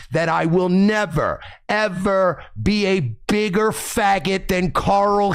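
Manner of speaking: slow, drawn-out mock vow; mock solemn pronouncement; earnest declaration. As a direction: spell it out slowly like an oath. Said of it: "I mean this when I say this".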